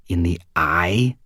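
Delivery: ordinary delivery, nothing marked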